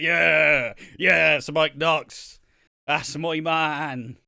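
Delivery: put-on loud, very slow Irish accent cheering; hammed-up Irish fan. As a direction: drag out each word in a loud Irish brogue. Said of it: "I know how you went Irish".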